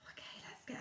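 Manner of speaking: fast hushed whisper; brisk secret whisper; quick whisper. Real, not acted. Teacher whispers to class.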